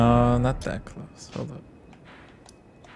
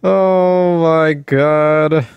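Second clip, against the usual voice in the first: very slow, loud groan of defeat; drawn-out despair; 4 semitones higher, 7 dB louder, much slower than usual. Real, not acted. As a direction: stretch it out in disbelief. Despair after losing runes.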